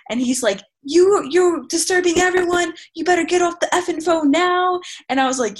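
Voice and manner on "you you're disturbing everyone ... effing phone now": higher, mock yelling man; angry commuter yelling. She imitates the man yelling at her to hang up. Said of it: "kept yelling at me".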